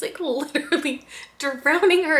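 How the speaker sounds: laughing in disbelief while telling it. Real, not acted. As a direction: giggles breaking through the words. Laughing while retelling book.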